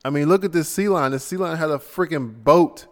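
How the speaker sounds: normal talking voice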